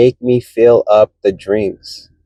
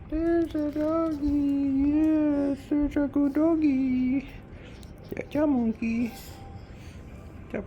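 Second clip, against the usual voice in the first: talking to a dog; 11 semitones higher, 17 dB quieter than usual. Real, not acted. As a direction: coo at the dog, much higher.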